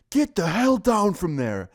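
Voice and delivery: high, drawn-out teacher's shout; angry shouted order. Retold or imitated. Teacher ordering student off the desk, acted angry shout. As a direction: imitate a teacher yelling a slow, forceful command.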